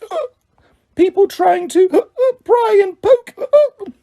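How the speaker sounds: high weeping house-elf voice; tearful drunken elf. A narrator's reading. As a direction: babble through drunken tears. Drunk Winky rants tearfully.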